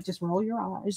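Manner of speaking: low, hushed dismissive aside. Retold or imitated. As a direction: say it softly under your breath. Retold reaction to videos.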